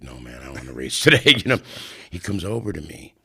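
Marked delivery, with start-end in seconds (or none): 0.0-1.3 laughing refusal. Retold or imitated. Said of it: "and I'm like".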